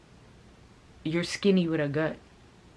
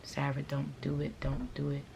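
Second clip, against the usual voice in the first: self-restraint; 7 dB quieter than usual. Retold or imitated. Vlogger telling herself not to insult the other woman, restrained. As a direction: talk yourself down quietly. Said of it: "I told myself".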